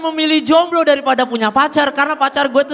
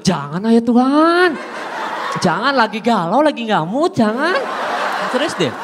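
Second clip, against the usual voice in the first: mock pleading; 2 semitones lower than usual. Comedian begging God not to call him, mock whiny plea. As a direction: beg off like a sulky kid.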